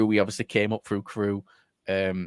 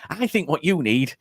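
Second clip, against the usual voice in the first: higher, louder chirpy verdict with a laugh; cheerful blunt news; 7 semitones higher, 6 dB louder than usual. Retold or imitated. Manager cheerfully telling player he's finished. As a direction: deliver the bad news happily. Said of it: "And he was like".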